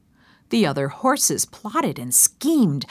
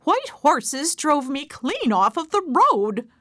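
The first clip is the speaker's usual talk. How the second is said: complaining geese; 6 semitones higher than usual. Acted. Geese complain about the horses.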